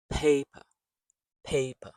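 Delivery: "Paper" with a punched first syllable; PAY-puh, strong start to "Paper" and weak schwa end; stressed syllable. Shown as a demonstration. Teacher shows rhythm in the word, "Paper" stressed on first syllable. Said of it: "there's a stress sound or syllable".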